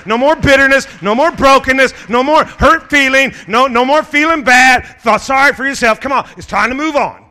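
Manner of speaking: loud, high, driving sermon build; rally the church, push them to move on; preaching at full force. Real, not acted. Preacher urging the congregation, loud rising call.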